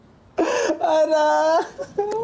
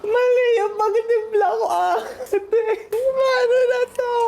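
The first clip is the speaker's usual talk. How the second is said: laughing panic; 9 semitones higher than usual. Real, not acted. Panicked over wrong gin mix.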